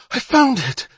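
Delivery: high, loud excited cry; breathless excitement. Acted. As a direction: cry it out, thrilled by the find. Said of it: "breathless with excitement".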